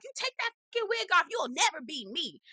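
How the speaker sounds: high-pitched imitation of a furious challenge; mock outrage. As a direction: act out jumping up to tell someone off. Acted quarrel, Lisa jumps up.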